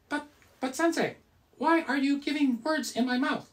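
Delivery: high squeaky puppet voice; squeaky ventriloquist dummy. Pretend. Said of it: "Coconut Monkey".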